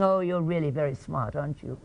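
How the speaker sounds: sarcastic. Shown as a demonstration. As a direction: say it so it means the opposite. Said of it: "What I'm communicated with the feeling".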